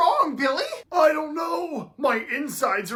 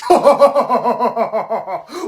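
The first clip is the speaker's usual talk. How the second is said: mocking laugh; 6 dB louder than usual. Mocking laugh at scaredy cat.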